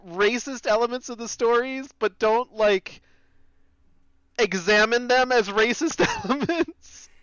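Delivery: high-pitched, barely over a laughing fit; real amusement squeezing the voice high. Real, not acted. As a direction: talk through leftover laughter, pitch way up. Still recovering from a laughing fit.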